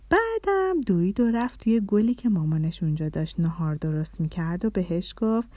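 plain reading voice

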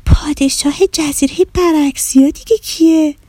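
put-on high, astonished child's question; surprised. A narrator's reading. Girl hearing of the island's king, surprised. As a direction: wonder in a high girl's voice. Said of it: "آدیشه با تعجب گفت".

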